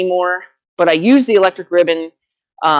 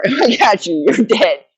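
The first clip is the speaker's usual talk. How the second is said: mock threat; 4 semitones higher than usual. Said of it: "my thoughts are".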